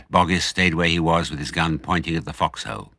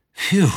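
relieved phew; 13 semitones higher, 4 dB louder than usual. A narrator's reading. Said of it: "said Mister Fox".

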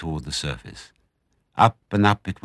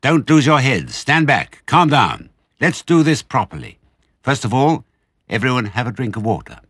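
loud, raised-pitch commands; ordering; 5 semitones higher, 6 dB louder than usual. A narrator's reading. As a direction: take charge of an excited pack. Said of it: "ordered Mister Fox".

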